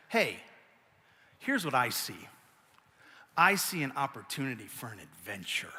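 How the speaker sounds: soft, low and gentle reassurance; calm her husband down softly; gentle wife. Retold or imitated. Wife gently calming her sulking husband, soft and low. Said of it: "and then also says".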